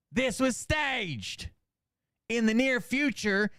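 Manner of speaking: high, declaimed email reading; theatrical read-out. Acted. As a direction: proclaim each phrase like a mock recital.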